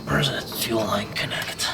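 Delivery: normal talking voice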